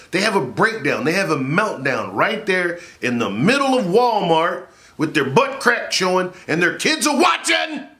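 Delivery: rising to a high shout; hammed-up outrage at a Walmart meltdown; building rant. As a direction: wind up to a shout.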